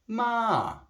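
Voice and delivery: "ma" starting high and dropping; high falling tone on "ma"; Chinese falling tone. Shown as a demonstration. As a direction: pitch "ma" high, then let it fall. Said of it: "the Chinese language is very much tonal".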